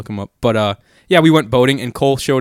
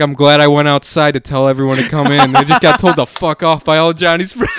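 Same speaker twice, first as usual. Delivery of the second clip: loud, pitched-up quote breaking into laughter; laughing retelling; 4 semitones higher, 7 dB louder than usual. Retold or imitated. Dad reporting back inside after being cursed, laughing imitation. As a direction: crack up while imitating the complaint.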